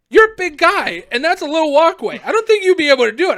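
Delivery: loud, high-pitched skeptical cop; imitated exasperated warning; mock doubt. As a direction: reason with him loudly like a doubtful officer. Retold cop doubts him.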